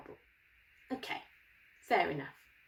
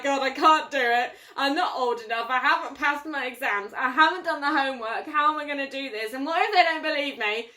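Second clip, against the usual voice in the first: put-on louder fretting kid-like Moses, half laughing; panicking Moses; 5 dB louder than usual. Moses complaining to God he can't do it, flustered. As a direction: rattle off excuses in a fluster. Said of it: "He's like".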